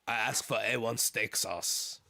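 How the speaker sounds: slow, badly faked French accent; deliberate mock French delivery. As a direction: put on a clumsy French accent. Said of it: "I'm retiring my French accent".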